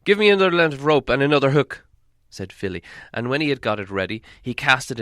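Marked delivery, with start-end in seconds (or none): 0.0-1.8 put-on loud, eager young dwarf. Young dwarf to the others, loud and brisk. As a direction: ask for the rope briskly.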